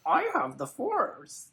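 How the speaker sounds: very slow, high Yoda voice. Pretend. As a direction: croak it out like Yoda. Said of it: "you've done Yoda".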